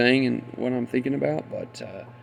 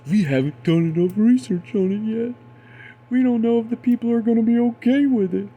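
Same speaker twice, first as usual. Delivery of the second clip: high louder mocking whine; mocking officials; 9 semitones higher, 4 dB louder than usual. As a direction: mimic the officials' excuse. Mocks officials' claim.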